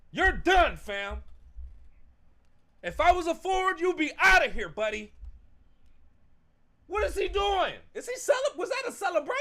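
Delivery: high, loud outraged yelling; angry yelling. Real, not acted. Fan yelling at his quarterback in outraged disbelief. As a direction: tear into him in disbelief.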